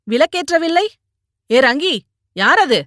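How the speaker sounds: high, loud call into the house. A narrator's reading. Mother coming home to a dark house, calling out high and loud. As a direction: raise your voice sharply as if calling ahead. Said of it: "என்று குரல் கொடுத்து கொண்டே நுழைந்தவள்".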